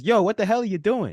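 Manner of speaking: loud, high shocked yell; startled yell. Retold or imitated. Bartender yelling at the man swinging his dog, shocked. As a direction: bark it at the guy across the bar. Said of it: "And the bartender says".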